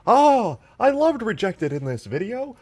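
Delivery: high swooning fan voice; mocking gushing voice. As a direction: gush over the hair dreamily. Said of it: "your mocking voice is Hank Hill".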